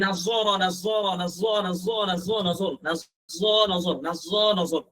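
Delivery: নাজর repeated with a rising end each time, slowing down; slow rising practice chant of নাজর; drilling repetition. Shown as a demonstration. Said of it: "এইভাবে করে করে করবেন".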